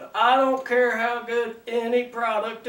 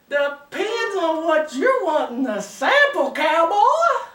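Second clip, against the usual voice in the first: high, teasing Southern belle, rising louder at the end; 6 semitones higher, 5 dB louder than usual. Acted. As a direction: come on to the cowboy as a bold Georgia belle. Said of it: "good-looking Southern belle".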